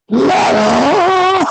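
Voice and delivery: high, strained single-word impression of an old coworker; imitating Mister Quan. Retelling coworker clutching his knee, high mock "Ladder.". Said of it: "he sounded exactly like that".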